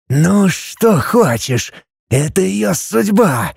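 slow, high serpent hiss; menacing hiss. Acted. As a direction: hiss it slowly like a threatening snake. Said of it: "грозно прошипел змей".

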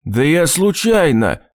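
high, drawn-out protest; defensive excuse. A narrator's reading. Husband excusing himself to family, defensive. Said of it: "попытался оправдываться Виктор".

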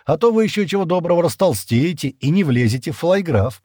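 high-pitched teasing banter; joking. A narrator's reading. Стэн jokes while unloading food boxes, teasing. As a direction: kid them playfully. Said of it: "пошутил он".

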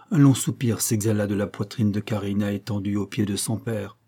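plain reading voice

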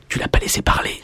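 unvoiced whisper of complaining fans. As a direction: whisper it like a grumbling fan. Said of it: "qui me disent".

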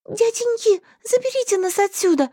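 high plaintive pleading. Acted. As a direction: beg them like a scared girl. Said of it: "жалобно взмолилась я".

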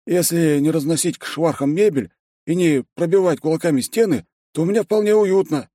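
high excited cheerful voice; Ben's voice. Acted. Ben answers in his voice.

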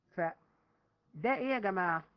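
usual speaking voice

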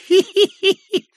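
put-on shrill old man tittering hee-hee; high creepy giggle. A narrator's reading.

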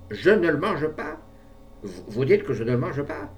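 outraged. A narrator's reading. Old man outraged challenge.

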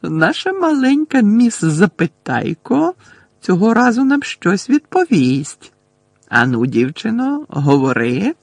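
slow, high gloating drawl easing into a command; mocking, drawn-out victory; triumphant. A narrator's reading. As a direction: taunt the girl in slow triumph. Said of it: "переможно проказала Амбридж".

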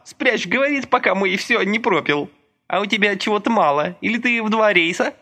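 high, brisk gossipy woman; chatty Masha. A narrator's reading. Masha chatters to Lika about the neighbour, high gossipy voice. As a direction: gossip to your friend, still catching breath.

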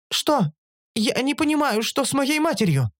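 high-pitched, puzzled female creature; creature Оша. A narrator's reading. Оша puzzled by the narrator's curse, high-voiced.

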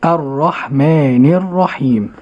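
الرحمن الرحيم recited slowly and carefully; tajweed repetition. Shown as a demonstration. Host echoing the sheikh's recitation slowly. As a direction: repeat after the sheikh slowly. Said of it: "host repeats after sheikh".

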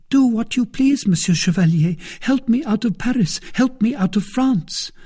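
high-pitched, urgent maid's plea; desperate little maid, pitched high; pleading maid. Acted. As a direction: beg for help in a high voice. Voices Kitty pleading.

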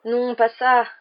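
a drawn-out plea to stop; weak protest. A narrator's reading. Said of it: "protesta faiblement".

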